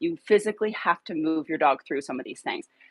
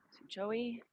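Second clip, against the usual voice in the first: usual speaking voice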